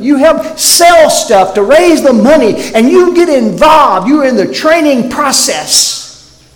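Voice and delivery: full-force pulpit preaching; fervent preaching. Acted. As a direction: preach it hard to the congregation.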